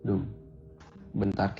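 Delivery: ordinary delivery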